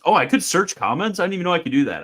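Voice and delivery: high, loud happy surprise; sudden discovery, delighted; surprised. Real, not acted. Discovers a search feature.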